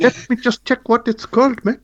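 laughing through a mock South African accent; bad South African accent, giggling. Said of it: "my South African accent".

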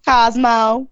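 Cosmo with a Southern drawl on the o, KAHZ-moh; Southern accent. Shown as a demonstration. Asked by co-host, she says Cosmo in a Southern accent. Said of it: "Cosmo in a Southern accent".

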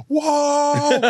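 high, drawn-out awed whoa; mock stunned teen-movie whoa; awed Weird Science guys. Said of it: "like Weird Science guys or something".

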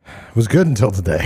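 ordinary talk

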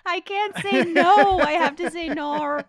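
very loud, high, drawn-out wail; playful exaggerated lament at full volume; mock anguished outcry. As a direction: cry it out slowly in hammed-up dismay. Playing the Australian's shocked realization.

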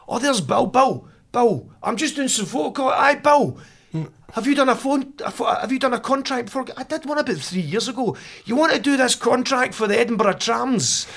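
high, put-on voices of council staff; imagined office chat. Mocking Edinburgh Council staff hiring Bill, high silly voices. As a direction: play the clueless council workers in a silly high voice. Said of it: "this is how I imagined it happened".

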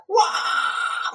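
high, breathy anguished wail, mock-imitated; imitated tortured scream. Telling the ghost story to his friend, imitating a tortured scream. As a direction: mimic a person being tortured, screaming. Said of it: "Teriak-teriaknya kayak orang kesiksa".

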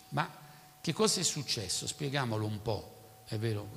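normal talking voice

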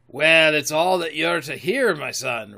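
put-on pirate captain putting the boy off; drawn-up, punchy storybook sea cook; Silver, sly pirate. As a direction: voice a wily old sea dog. Silver answers Jim.

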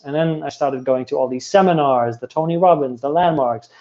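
ordinary delivery

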